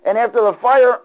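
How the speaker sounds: usual speaking voice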